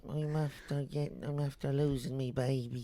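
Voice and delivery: pitiful Dublin beggar's sob story; dublin accent. Acted. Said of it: "begging in a Dublin accent".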